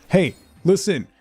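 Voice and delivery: ordinary talk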